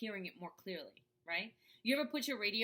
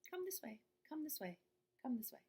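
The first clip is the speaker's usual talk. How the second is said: higher, soft, easing down; gentle inner voice; 4 semitones higher, 7 dB quieter than usual. Shown as a demonstration. Intuition calling the listener softly, high and gentle. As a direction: coax softly like a small voice. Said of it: "It's like".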